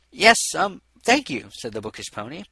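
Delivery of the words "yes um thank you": higher awkward thanks; flustered. Acted. Bookish pony thanking the over-eager doctor, higher and awkward. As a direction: stammer out thanks, unsettled. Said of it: "perturbed by his enthusiastic greeting".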